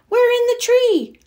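high, loud reply from the tree; shouting back. Acted. Fox calling back to his mother from the treehouse, shouting. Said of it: "Fox shouted back".

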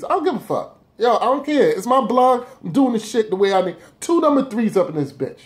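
high, fired-up don't-care rant; brushing off his double number three with bravado; defiant. Real, not acted. Defiant about his own blog.

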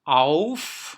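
"Auf" loud and drawn out, AU as "ow"; AU diphthong. Shown as a demonstration. Teacher demonstrating A U, loud stretched diphthong. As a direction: call out "Auf" with a full "ow" glide. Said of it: "au diye okunur".

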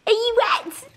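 laughing along as the dogs race round the kitchen; excited. Real, not acted. Excited at dogs' zoomies.

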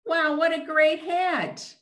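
high gushing praise; admiring puppet voice. Acted. Cyclops puppet praising the cake's hat, high and gushing. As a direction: gush over the hat.

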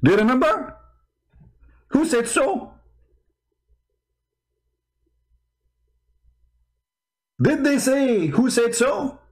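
high, mocking echo of Who said so; a hint of a mocking debater. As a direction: needle him by parroting Who said so. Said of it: "remember what Mimi Hijab he said".

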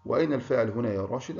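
normal talking voice

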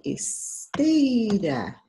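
"Esteira" with "tei" drawn out, high; ударный слог. Shown as a demonstration. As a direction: hold the strong "tei" in "Esteira" long. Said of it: "E a sílaba mais forte".

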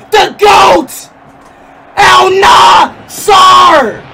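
loud, high, drawn-out hype; excited shout. Real, not acted. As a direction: yell it like a fan. Hyped rant on card.

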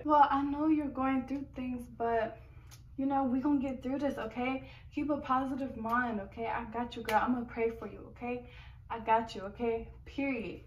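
soft comforting voice; calm her down gently; reassuring. Acted. Skit, comforting friend.

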